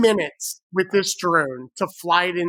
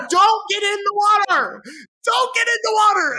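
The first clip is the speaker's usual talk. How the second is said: much higher panicked shout, repeated; 12 semitones higher, faster than usual. Retold or imitated. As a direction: yell at a friend not to jump in. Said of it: "I'm like".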